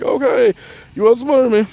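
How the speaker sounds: high, loud put-on surrender; mock defeated concession. Her giving in to a tricky Gemini friend, high and mock-defeated. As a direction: concede with a silly high whine.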